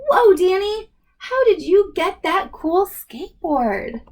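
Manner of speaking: Jakey gasping at the cool board; amazed friend. Acted. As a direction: kid blown away by the skateboard.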